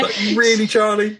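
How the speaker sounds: high squeal of laughing disbelief; incredulous, breaking into laughter. Real, not acted. Amused disbelief at character.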